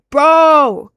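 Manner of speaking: loud, disbelieving "Bro!"; exasperated shout. Real, not acted. Streamer shouting at a viewer's dating question. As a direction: call him out, loud and incredulous.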